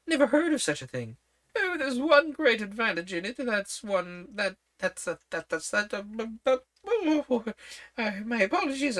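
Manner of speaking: put-on high, fussy old queen. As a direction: talk up high like a flustered queen.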